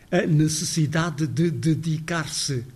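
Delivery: A necessidade de dedicar-se said slowly with all vowels; careful full form. Shown as a demonstration. Lecturer shows the over-careful version nobody uses. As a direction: articulate every syllable deliberately. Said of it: "Mas também não falamos assim".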